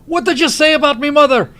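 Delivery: loud, high Scottish outburst; enraged Groundskeeper Willie. Retold or imitated. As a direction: bellow it in outrage with a Scottish burr. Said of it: "He just gets completely enraged".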